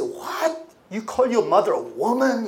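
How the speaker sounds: imitating scandalized critics; mock indignation. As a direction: scoff like an offended critic. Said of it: "And they say".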